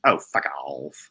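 low, drawn-out weird voice. Retold or imitated. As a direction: imitate a singer telling friends off, slow and low. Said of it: "in this really weird voice".

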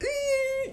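high, drawn-out squeal of delight; thrilled "ee"; excited squeal. Real, not acted. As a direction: let a giddy little squeal out.

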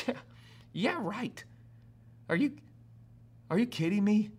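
incredulous scoff, voice lifting on "yeah"; acted-out mocking disbelief; scoffing laugh. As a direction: brush it off with a disbelieving little laugh. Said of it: "this first laugh that she gives".

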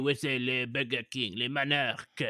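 hammed-up bad French accent; attempted French accent. Shown as a demonstration. Proving he isn't vomiting, mock French accent. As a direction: push the fake French vowels. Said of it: "this is me doing a French accent".

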